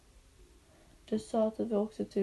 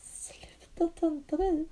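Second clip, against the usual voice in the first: whispered scolding; 6 semitones higher, 4 dB quieter than usual. Real, not acted. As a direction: tell her off under your breath. Whispered reply.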